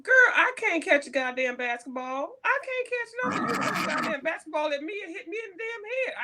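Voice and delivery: venting to a friend about being forced into basketball, exasperated and loud; exasperated rant. Real, not acted. Friend hears about forced basketball, exasperated.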